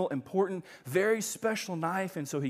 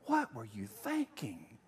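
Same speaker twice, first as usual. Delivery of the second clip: low, slow, softer disappointed question; disapproving; 6 semitones lower, 6 dB quieter, slower than usual. Pretend. Pastor pictures Jesus quietly disapproving of him. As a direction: let the disappointment sink in slowly. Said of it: "I just picture him saying to me".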